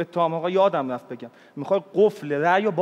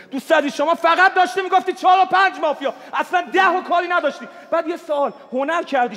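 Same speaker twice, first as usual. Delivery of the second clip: heated shouting; 8 semitones higher than usual. Real, not acted. Heated mafia game argument.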